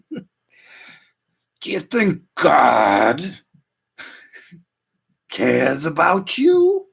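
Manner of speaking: very slow, stretched-out movie devil taunt; Al Pacino. Acted. Devil taunting a believer about God, hammed-up Pacino impression. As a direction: draw every word out, rising high at the end. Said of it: "Al Pacino and Devil's Advocate impression".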